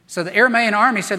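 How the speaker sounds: ordinary talk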